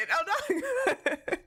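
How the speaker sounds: high voice breaking into real laughter; laughing admission. Real, not acted. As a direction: try to say it while cracking up. Cracking up, admits not being good.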